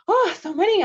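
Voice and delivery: high, loud exclamation; overwhelmed by the flood of names; surprised. Real, not acted. Host swamped by greetings in the chat, high surprised oh.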